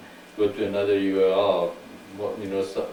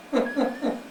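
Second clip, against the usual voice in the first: short laugh. Real, not acted. Laughing during robot bit.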